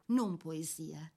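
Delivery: normal talking voice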